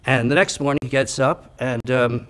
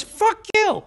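very high parrot voice; swearing parrot. Acted. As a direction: curse like a parrot in a tiny high voice. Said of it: "Parrot says".

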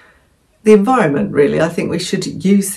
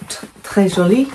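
mock French accent; 3 semitones higher than usual. Reading a French label on her beach bag, hammy French accent. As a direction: read the label like a proud but hammy French speaker. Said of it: "My very good French accent".

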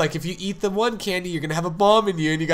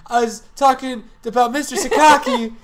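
gushing character voice; 7 semitones higher, 5 dB louder than usual. Acted. Character gushes over Sakaki.

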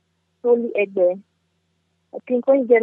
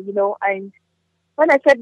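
normal talking voice

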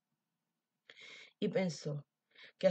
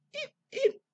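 lamb hiccups; 4 dB louder than usual. Acted.

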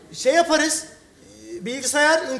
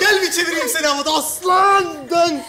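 loud, higher whoops of joy; excited cheering; 4 semitones higher, 4 dB louder than usual. Acted. Boyfriend cheers his girlfriend's acceptance in England, excited shouting. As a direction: cheer her on as you try to spin her.

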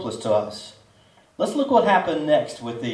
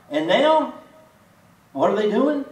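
ordinary talk

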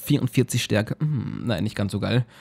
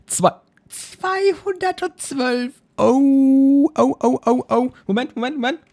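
very high, squeaky excitement; thrilled disbelief; 11 semitones higher, 3 dB louder, slower than usual. Real, not acted. Gamer spots great new boots, squealing in thrilled disbelief. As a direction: squeal it out, stretching the number.

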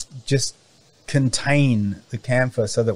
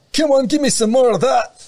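much higher, loud, cartoonish Scottish accent; 6 dB louder than usual. Camphor saplings growing back and goading the farmer, hammed-up Scottish accent. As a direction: taunt in a high-pitched put-on Scottish voice. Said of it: "I went for Scottish one".

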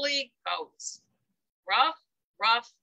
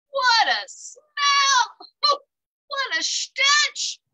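very high, loud, slow cry of disgust; 12 semitones higher, 4 dB louder, much slower than usual. A narrator's reading. Narrator reacting to the skunk smell, loud disgust. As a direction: stretch it out, overcome by the stench.

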